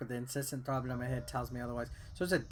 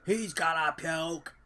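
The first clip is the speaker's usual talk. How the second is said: ominous slow drawl; 2 semitones higher, 4 dB louder, slower than usual. Pretend. Mock dramatic gamer commentary.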